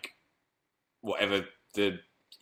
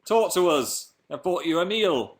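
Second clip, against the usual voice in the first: higher, drawn-out mock parent voice; nagging parents; 7 semitones higher, 3 dB louder, slower than usual. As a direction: put on pleading, scolding parents. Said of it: "My parents would say".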